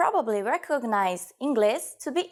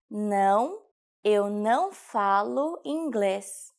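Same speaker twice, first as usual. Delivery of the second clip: slow, word-by-word model sentence; slow teaching repetition; 2 semitones lower, slower than usual. Shown as a demonstration. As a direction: stretch "Não, eu não falo inglês" out for learners. Teacher repeats sentence slowly. (slowed-down language-lesson delivery)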